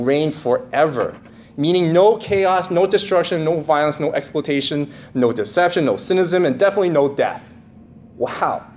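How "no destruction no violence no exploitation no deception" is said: normal talking voice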